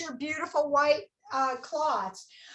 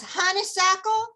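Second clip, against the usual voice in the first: honeysuckle in a playful Southern accent, pitched up; 5 semitones higher, 4 dB louder than usual. Shown as a demonstration. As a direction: put on a Southern twang, higher. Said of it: "say this with a Southern accent".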